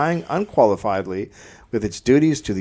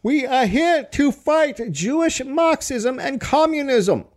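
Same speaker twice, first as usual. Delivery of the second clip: high, slow, hammed-up rally speech; mock rally oration; mock Fritz speech; 13 semitones higher than usual. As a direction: orate it like a ranting rally leader, much higher and slow. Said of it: "Fritz spoke".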